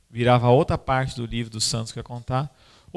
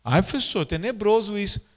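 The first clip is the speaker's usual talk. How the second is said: put-on whiny teenagers; 7 semitones higher than usual. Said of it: "Os jovens assim, ó".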